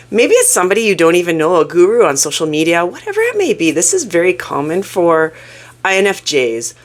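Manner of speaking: ordinary talk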